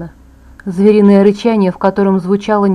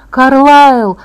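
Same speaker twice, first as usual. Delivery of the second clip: loud, higher cry of despair; desperate call; 4 semitones higher, 7 dB louder than usual. A narrator's reading. Angel calling Carlisle to help Bella, desperate cry. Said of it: "отчаянно позвал ангел".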